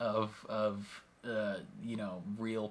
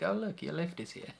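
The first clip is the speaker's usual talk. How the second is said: lightly voiced chirpy spy, pitched up; tossed off breezily, like good news; a hint of a cheerful British agent; cheery; 7 semitones higher than usual. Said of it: "she's just so cheery".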